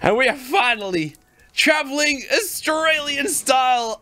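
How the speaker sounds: high, exuberant shout; triumphant. Real, not acted. Player celebrates the first kangaroo ride, triumphant. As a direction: proclaim it like a big victory.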